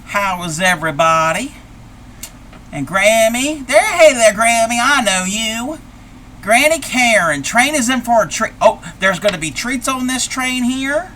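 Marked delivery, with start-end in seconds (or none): 2.7-11.1 high, delighted hello to another grandma; light up greeting an old friend; squealing welcome to Granny Karen's pal; excited granny greeting. Acted.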